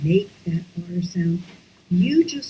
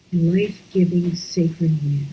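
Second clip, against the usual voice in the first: whispered; 3 semitones lower than usual. Shown as a demonstration. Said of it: "breathy whispered slow".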